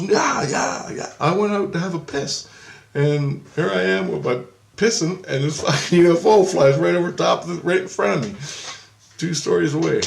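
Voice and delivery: high-pitched, worked-up retelling; excited friend. Retold or imitated. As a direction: imitate a friend gushing about an incredible sighting. Retold friend excitedly recounts UFO sighting.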